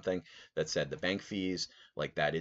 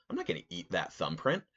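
ordinary delivery

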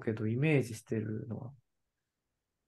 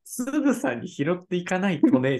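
imitating her line, pitched higher and louder; mocking repeat; 6 semitones higher, 5 dB louder than usual. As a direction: parrot it back mockingly. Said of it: "じゃないよ".

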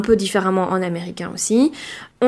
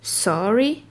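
British sorry; "Sorry" with a round O; British English; 4 dB quieter than usual. Shown as a demonstration. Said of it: "Pareil pour le mot désolé". (en anglais britannique, "sorry" avec un O rond)

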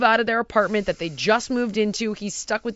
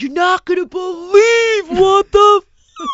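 higher, louder, crying shout; panicked shout; 9 semitones higher, 11 dB louder, slower than usual. Retold or imitated. Brother phoning her in a panic, higher crying shout. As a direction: yell it like your brother in a panic, slow.

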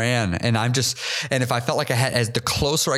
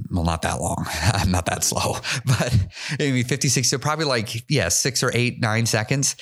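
usual speaking voice

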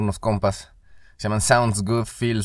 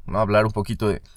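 normal talking voice

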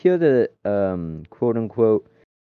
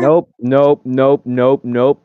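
louder repeated nope, backing away; rapid nope refusal; 3 semitones higher, 6 dB louder, much slower than usual. Real, not acted. Friend reacts to horrifying generator result, repeated loud nope. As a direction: say nope over and over, recoiling.

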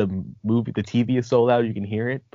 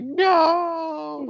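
high, loud drawn-out wail; long, hammed-up protest shout; dismayed outcry; 5 dB louder than usual. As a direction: cry out like a fan in mock anguish. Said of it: "I was like".

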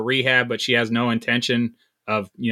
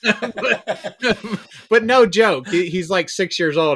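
laughing; 7 semitones higher, slower than usual. Real, not acted. Laughing at the absurd show.

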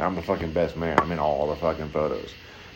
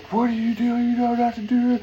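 high, louder scolding scream; parents scolding; 5 dB louder than usual. Retold or imitated. Parents screaming at their rescued son, high and angry. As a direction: yell at the kid who nearly drowned.

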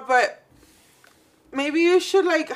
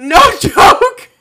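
ordinary talk

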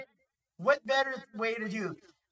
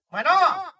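answering the door; 7 semitones higher, 9 dB louder than usual. Retold or imitated.

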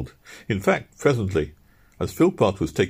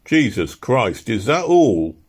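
slow, louder mock growl; a weary, lightly done growl; 6 semitones higher, 5 dB louder, slower than usual. Easton groaning at the time, slow mild growl. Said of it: "growled Easton".

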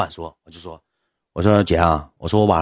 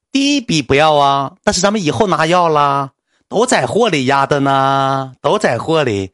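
assistant's voice; 5 semitones higher, 5 dB louder, slower than usual. Retold or imitated. Assistant explains the hidden cost.

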